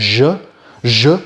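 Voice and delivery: "Je" as a buzzy French "zh"; demonstrated "zh" sound. Shown as a demonstration. Showing the sound broadcasters wrongly use, exaggerated "Je" as "zhuh". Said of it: "using a sound that doesn't even exist in Chinese".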